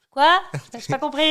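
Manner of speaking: sudden loud high shout, straining to hear; shouting over noise. Retold or imitated. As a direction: call out over a noisy crowd. Shouting over a noisy convention hall.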